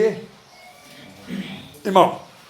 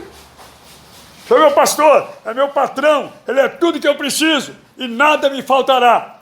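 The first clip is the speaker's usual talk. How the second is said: fervent proclamation; 6 semitones higher, 3 dB louder than usual. Shown as a demonstration. Pastor shows the church how to truly believe it, fervent. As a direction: proclaim it like you mean every word. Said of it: "Você não crê naquilo?".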